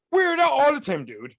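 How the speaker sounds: loud, high incredulous rant; exasperated outburst. Real, not acted. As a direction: blurt it out in disbelief. Ranting about vigilante masks.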